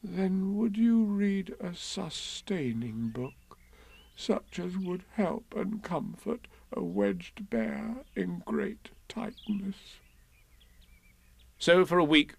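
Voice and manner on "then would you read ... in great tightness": slow, soft plea fading to a whisper; wedged Pooh tearfully pleading for a book. Acted. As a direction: beg gently, close to tears. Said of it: "a tear rolled down his eye".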